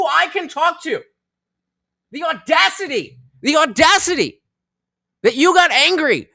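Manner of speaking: high, emphatic indignation; retold outrage. Dog walker berating man who got scared, high indignation. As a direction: lay into him, hammering key words.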